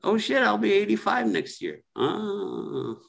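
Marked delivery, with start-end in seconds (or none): none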